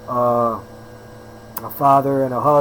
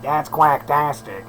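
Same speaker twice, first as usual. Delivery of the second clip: lightly mimicked, drawn-out movie catchphrase; Adam Sandler; slower than usual. Trainer quoting a funny movie line, light comic imitation. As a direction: stretch it out slowly like a comic quote. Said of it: "He goes like".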